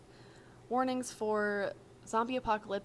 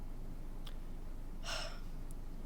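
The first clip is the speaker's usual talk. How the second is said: heavy sigh; 7 dB quieter than usual. Acted. Logan sighs before answering.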